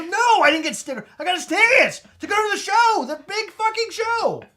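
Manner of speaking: high, loud thrilled exclaiming; bursting with excitement over tickets; excited. Retold or imitated. Excited correction.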